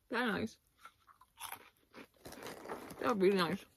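chewing while talking; speaking around food; mouth full. Real, not acted. Seen 1: Puts small pieces in mouth, chews visibly while talking.

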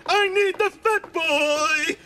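high, slow, swooning voice; gushing admirer. Retold or imitated. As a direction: croon it like a lovestruck woman. Reads her gushing reply in voice.

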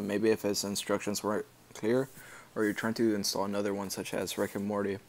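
ordinary talk, nothing marked

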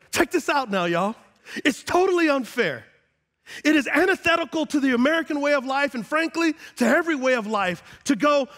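loud, high, building sermon climax; preaching at full force. Acted. Pastor rousing the congregation, loud and high. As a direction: preach it to the back row.

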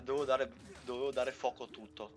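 ordinary talk, nothing marked

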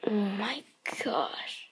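slow awestruck whisper. Real, not acted. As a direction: whisper it in awe. Whispered awe at card.

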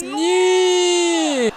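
very high, long drawn-out scream; mock child's wail. Frankie begs dad not to go, mock wailing. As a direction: wail it like a distraught kid.

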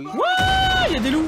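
sudden high loud yelp; startled shout. Real, not acted. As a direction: cry out in surprise. Startled by wolves. (cri de surprise)

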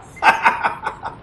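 short unvoiced cackle; laugh. Commentator amused by enemy driving by, laughing. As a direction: burst out in a chuckle.

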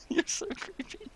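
laughing. Real, not acted.

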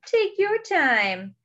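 high, drawn-out storybook turtle; slow turtle. Acted. Turtle to Duck and Hippo in line, slow turtle voice. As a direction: stretch each word unhurriedly. Turtle repeats it more slowly.

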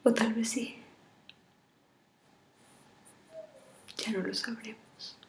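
tearful, half-whispered farewell; tragic heroine sorrow. Acted. Farewell video to lover.

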